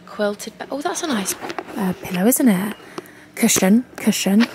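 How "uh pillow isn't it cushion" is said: usual speaking voice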